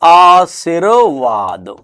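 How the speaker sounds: आशीर्वाद very slowly as "aa-sir-vaad"; dictation. Shown as a demonstration. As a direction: dictate आशीर्वाद in long, separated syllables. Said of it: "और ऐसे लिखेंगे क्या".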